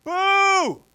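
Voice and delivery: high, loud heckle; mock screamed boo. Comedian imitates a lady heckling his bad set, screamed boo. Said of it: "she didn't scream out".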